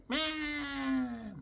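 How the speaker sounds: very high imitated meow; high squeaky meow; parrot meow. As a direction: mimic the bird's meow. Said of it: "he just go like".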